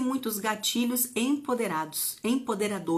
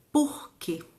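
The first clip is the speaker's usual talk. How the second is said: ordinary talk, nothing marked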